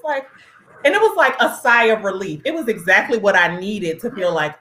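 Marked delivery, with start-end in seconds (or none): none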